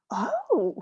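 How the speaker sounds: breathless, wide-eyed surprise; a gasp of sudden discovery; amazed discovery. Retold or imitated. Someone spotting a path that was always there, amazed.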